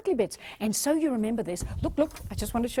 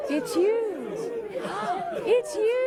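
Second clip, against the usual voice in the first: high gasping delight; thrilled high it's you; 11 semitones higher, slower than usual. Shown as a demonstration. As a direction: gasp and light up at them. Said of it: "on the count of three".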